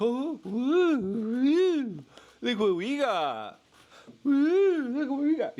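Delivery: high, loud sing-song taunt; mock the government's teasing; mocking tease voice. Mocking officials teasing the public, high sing-song voice.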